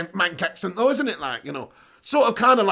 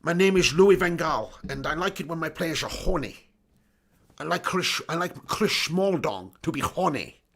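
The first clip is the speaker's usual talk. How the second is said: put-on slurred Dutch accent with shushed s; Louis van Gaal impression; 3 semitones lower than usual. Streamer as the manager presenting his Dutch crew, comic Dutch accent. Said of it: "Do L V G saying".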